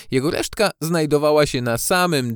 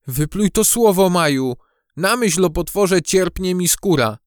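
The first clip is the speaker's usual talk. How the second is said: higher voice, spooked protest; alarmed cry; 6 semitones higher, 3 dB louder than usual. A narrator's reading. Gucio dreads the word monster, alarmed cry. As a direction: protest as if the word gives you shivers. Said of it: "zawołał Gucio".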